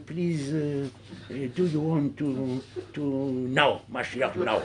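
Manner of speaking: half-hearted begging in English; mock a limp prayer for Mashiach; mock timid plea. (supplication molle et moqueuse)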